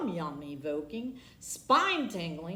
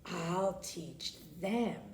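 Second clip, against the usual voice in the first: slow, softer hissed whisper; breathy whispered hiss, much softer; 3 semitones lower, 5 dB quieter, slower than usual. Acted. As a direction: hiss a spiteful threat. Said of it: "hissed blabbering Beth Ann".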